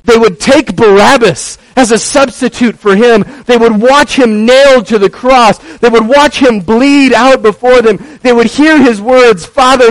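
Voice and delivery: loud, high, ringing sermon climax; full-force preaching. Acted. Pastor telling the crucifixion to the church, fervent. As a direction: build the crucifixion scene fervently.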